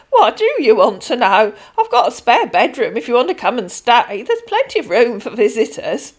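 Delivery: a kindly pensioner rambling on; old lady Denise. Acted. Voices Denise.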